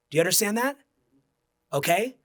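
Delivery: ordinary delivery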